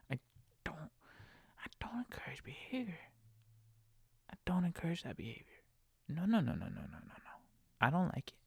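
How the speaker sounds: hushed whisper, no no no; whispered insistence. Real, not acted. Driver insisting he won't encourage small talk, whispered. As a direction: whisper it firmly to yourself.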